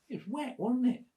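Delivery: high-pitched put-on girl; imitated girl's complaint. Girl explaining her terrible night, imitated complaint. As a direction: mimic her protest in a high voice. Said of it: "She said".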